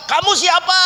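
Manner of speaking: loud, high shouted demand; high-pitched, forceful question; acted interrogation. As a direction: demand it like an exorcist, re-enacted. Acts out questioning the possessed.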